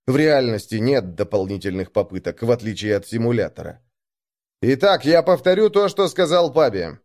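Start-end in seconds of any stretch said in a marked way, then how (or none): 4.6-7.0 louder and higher, commanding; raised voice. Acted. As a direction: raise your voice over the crowd. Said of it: "повысил голос я".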